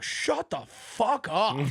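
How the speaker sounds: imitating a judge brushing a guy off; mock slow dismissal. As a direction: drag it out mockingly. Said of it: "He looks over, he's like".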